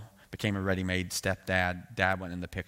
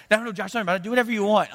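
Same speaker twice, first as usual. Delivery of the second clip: high, loud mock-casual reassurance; easygoing pastor; 14 semitones higher, 10 dB louder, faster than usual. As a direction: wave it away breezily, pitched way up. Pastor Marty shrugs off calling.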